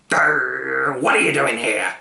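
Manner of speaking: a hint of a gruff dog growl; growling watchdog. A narrator's reading. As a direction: growl the question at the boy. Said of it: "growled the watchdog".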